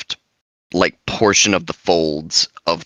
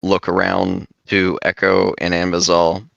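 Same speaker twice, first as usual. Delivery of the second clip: normal talking voice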